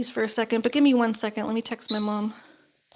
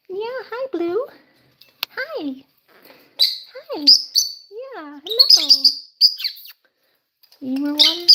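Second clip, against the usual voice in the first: pet talk; 6 semitones higher, 4 dB louder, much slower than usual. Real, not acted. Greeting her pet bird Blue.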